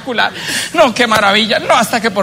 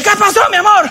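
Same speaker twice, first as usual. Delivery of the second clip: much higher shouted call; 12 semitones higher than usual. Acted. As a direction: yell to the kitchen. Husband shouting to kitchen.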